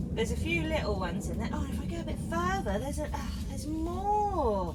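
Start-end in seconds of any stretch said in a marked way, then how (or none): none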